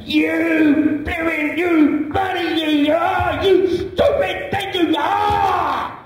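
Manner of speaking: high, slow, spluttering rant at the sat-nav; hammed-up furious driver; ranting at a stupid machine; mock-angry shout. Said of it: "So he's getting angry".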